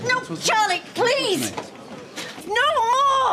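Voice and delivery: high, loud desperate screams; frantic pleading. Acted. Woman begging Charlie to stop fighting, screaming frantically.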